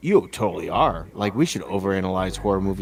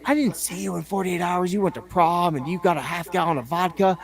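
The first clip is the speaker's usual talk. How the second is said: higher, whiny imitation of his little sister; mock sister voice; 9 semitones higher than usual. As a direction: mimic the nagging sister, pitched up. Sister voiced in a retold argument.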